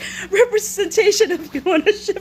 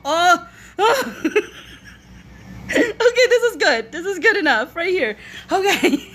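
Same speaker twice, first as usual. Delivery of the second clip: usual speaking voice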